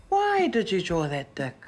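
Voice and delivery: ordinary delivery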